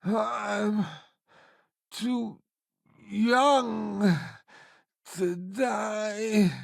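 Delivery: frightened, halting plea; scared. Acted. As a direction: beg for your life, haltingly. Panicking at danger.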